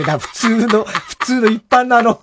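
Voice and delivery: usual speaking voice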